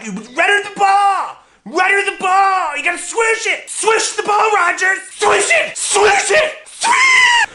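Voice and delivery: put-on bratty kid yelling at a teammate; shouted commands. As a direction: bark orders like a bratty kid coach. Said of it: "Scotty would be just shouting commands at me".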